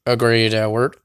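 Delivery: ordinary delivery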